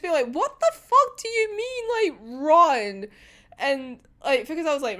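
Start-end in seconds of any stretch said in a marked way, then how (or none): 0.3-3.1 high, slow, baffled question; baffled disbelief, starting high and dropping; very confused. Acted. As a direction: act out utter confusion. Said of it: "Atsuse just looking at him very confused".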